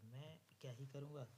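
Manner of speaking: ordinary talk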